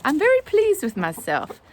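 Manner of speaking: high, loud, pleased voice; self-satisfied chicken. Acted. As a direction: crow it like a proud bird. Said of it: "the chicken sound that says".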